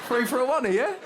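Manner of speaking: lightly voiced cocky lad; young geezer voice. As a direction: bargain like a street dealer. Old man thinks he's young dealer.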